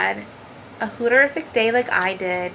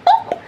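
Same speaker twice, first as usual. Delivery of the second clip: tag; 4 dB louder than usual. Retold or imitated. Imitates sound of popping it open.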